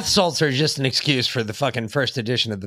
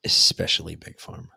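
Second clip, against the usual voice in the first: sarcastic whisper; 11 semitones lower than usual. Pretend. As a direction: breathe it like a secret.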